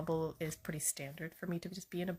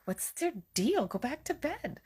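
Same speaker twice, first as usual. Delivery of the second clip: mock exasperation; 8 semitones higher than usual. Retold reply to angry husband.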